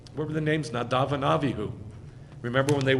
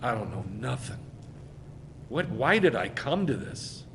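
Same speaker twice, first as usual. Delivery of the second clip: lower, softer stunned muttering; much lower and softer, quietly humbled; 5 semitones lower, 5 dB quieter than usual. Retold or imitated. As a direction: drop low and soft as if floored. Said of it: "they're like".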